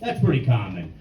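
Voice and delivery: ordinary talk, nothing marked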